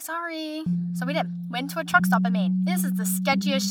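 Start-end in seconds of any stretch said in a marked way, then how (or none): 0.0-0.6 small, higher mock apology; sheepish apology. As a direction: softly play a not-very-sorry kid. Sheepish reply to complaining dad.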